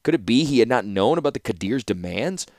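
ordinary delivery

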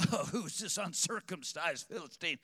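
lightly played bravado, showing off to the guys; mock swagger. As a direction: puff your chest out like one of the boys. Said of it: "he's gonna throw his chest out a little bit".

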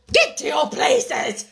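drawn-out, high shouted command; voice of thunder. A narrator's reading. The Queen orders players to their places, thunderously. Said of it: "in a voice of thunder".

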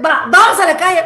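high, loud, laughing exclamation; retold exasperated giving-in. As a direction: give in loudly to the barking dog. Acts out giving in to dog.

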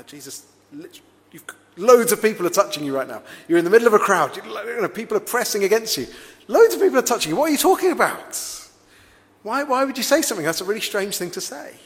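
high, loud, baffled protest to his master; an awkward disciple blurting out in disbelief; awkward Peter. Retold or imitated. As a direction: Peter pushing back, flustered and incredulous. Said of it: "sort of looking a bit awkwardly going".

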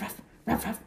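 ordinary delivery, nothing marked

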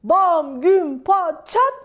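loud, punched-out sound words; big storybook bangs; booming crash noises. A narrator's reading. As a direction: hit each crash word hard, separately. Crash noises of the landing ambulance.

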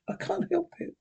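high, soft, husky apology; quiet, husky child's voice; husky boy. A narrator's reading. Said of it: "said the boy huskily".

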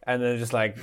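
normal talking voice

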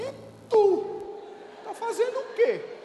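high, loud retort in mock surprise; mock astonished comeback. Pastor turning to his friend abroad, mock astonished.

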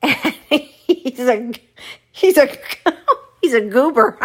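helpless giggles before the punchline; cracking up. Real, not acted. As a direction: stumble over it laughing.

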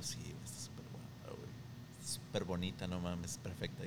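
hushed, breathy daydream admiration; dreamy whisper. Retold or imitated. Schoolboy on a bench gushing about a passing girl, whispered. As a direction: whisper it like you're smitten.

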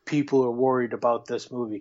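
ordinary talk

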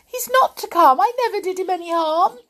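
high, loud squeaky protest; frightened squeal. A narrator's reading. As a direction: plead as a terrified little mouse. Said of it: "squealed the Clockwork Mouse in a fright".

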